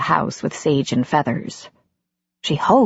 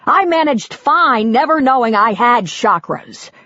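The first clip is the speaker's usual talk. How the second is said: husband ranting about his wife's rules; 4 semitones higher than usual. Acted. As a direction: let it all boil over.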